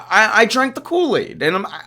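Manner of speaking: ordinary talk, nothing marked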